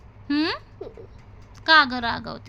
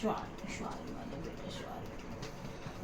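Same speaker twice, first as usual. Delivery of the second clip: much softer whispered apology; tender whisper; 9 semitones lower, 19 dB quieter, much slower than usual. Real, not acted. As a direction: breathe the sorry gently into her ear. Mother apologises to her child.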